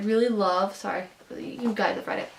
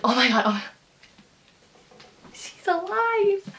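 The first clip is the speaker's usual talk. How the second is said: thrilled disbelief; 10 semitones higher, 4 dB louder than usual. Retold or imitated. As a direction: relive the shock of a character coming back. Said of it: "and I was like".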